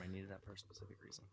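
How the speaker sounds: hushed under-breath aside; whispering. Real, not acted. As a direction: whisper it to yourself. Muttered aside about the alarm.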